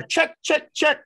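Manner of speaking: high yelled call across the field; coach yelling signals. Retold or imitated. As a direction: bark the code at the batter. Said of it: "He yells to the batter".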